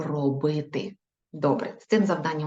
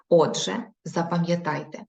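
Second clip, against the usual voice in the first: ordinary talk, nothing marked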